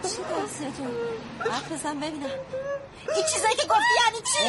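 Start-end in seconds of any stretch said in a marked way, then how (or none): 3.2-4.5 fast, loud demand; shouting again. Acted. Daughter shouting at the crying girl, fast and loud. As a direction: fire the question at her. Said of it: "باز داد زد".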